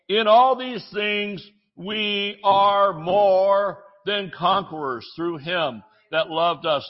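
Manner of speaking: slow, stretched preacher climax; drawn-out triumphant preaching; preaching at full force. Acted.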